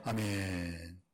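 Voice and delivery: ordinary delivery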